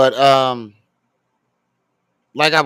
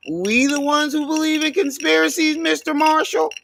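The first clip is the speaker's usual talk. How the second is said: high mocking voice, pitched way up; mocking imitation; 12 semitones higher than usual. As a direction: parody the conspiracy fans in a high put-on voice. Mocking the streamer's followers.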